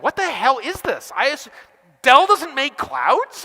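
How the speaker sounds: hammed-up disbelief at the hardware; mock outrage. Frustrated customer voiced.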